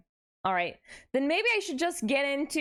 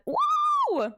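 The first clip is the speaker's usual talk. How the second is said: playful whoop; 5 dB louder than usual. Pretend. Connection lurching, playful yelp.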